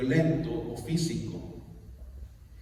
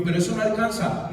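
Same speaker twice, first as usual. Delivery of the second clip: higher-pitched dismayed protest; alarmed objection; 8 semitones higher, 4 dB louder than usual. Retold or imitated. Husband objecting to wife's money plan, alarmed. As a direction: re-enact a startled objection.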